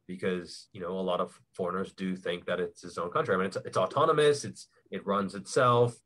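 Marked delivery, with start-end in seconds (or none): none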